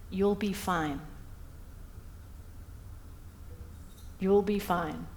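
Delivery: soft, gentle reassurance from a mother; a mild, soothing promise to her son; reassuring mum. Retold or imitated. Mum reassures her son after failed exams, softly and kindly.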